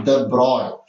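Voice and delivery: de Broglie said as "duh BROY"; de Broglie ending in an "oy" sound; correct pronunciation. Shown as a demonstration. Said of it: "it's called".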